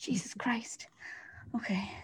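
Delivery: breathless whispered relief; whispered exasperation. Real, not acted. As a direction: whisper it after a scare. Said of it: "being assaulted by a daddy long legs".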